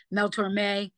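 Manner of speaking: ordinary talk, nothing marked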